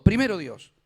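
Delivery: loud, firm declaration; preaching emphasis. Real, not acted. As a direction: proclaim it as the final word. Preacher's climactic refrain.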